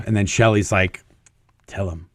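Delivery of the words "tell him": a soft low nudge, said under the breath; wife-like hushed coaxing to speak up; quiet prodding. Retold or imitated. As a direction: urge him on quietly, close by. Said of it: "she's prodding me".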